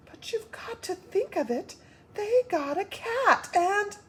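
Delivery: high whispered urgency; frightened whisper. Acted. Wife whispering her fear to husband, urgent whisper. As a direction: whisper it in dread.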